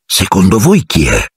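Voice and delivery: usual speaking voice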